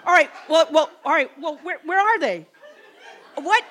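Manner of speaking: half-laughing disbelief into the phone; amazed. Retold or imitated.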